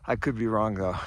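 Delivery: ordinary talk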